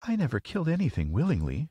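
put-on high, plaintive little girl; a touch of sobbing, pitched high; mild tearful protest. A narrator's reading. Girl refusing the wizard's task, mildly tearful. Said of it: "she sobbed".

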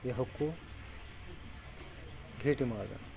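ordinary talk

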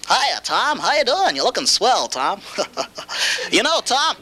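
mock cheery visitor. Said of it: "usually hear the lies like".

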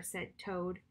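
usual speaking voice

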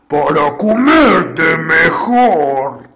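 slow, loud wolf shriek; wolf screeching as it pounces. Acted. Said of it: "chilló el lobo".

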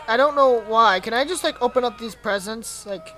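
ordinary talk, nothing marked

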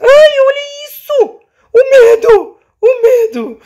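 scared. Pretend. Mock fear at Inside Out character.

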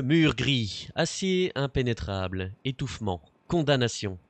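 slow dramatic poem recital, lightly done; poem reading. Acted. Reading the kid's gloomy birthday poem aloud, mildly dramatic. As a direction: give each word weight like a grim poem.

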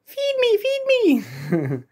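squeaky begging chick; cartoon bird voice. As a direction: beg like a hungry chick.

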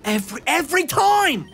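high, drawn-out cry of frustration; exasperated outburst. Real, not acted. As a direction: wail at your rotten luck, slowly. Exasperated at repeated bad luck.

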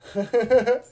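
loud, high, quick chuckle; giggly laugh. Real, not acted. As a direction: burst into a short giggle. Amused before greeting a viewer.

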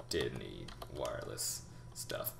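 ordinary talk, nothing marked

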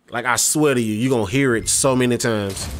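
ordinary talk, nothing marked

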